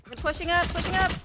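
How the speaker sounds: loud urgent warning shout; urgent callout. Real, not acted. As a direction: call it out to the team. Callout as enemies push.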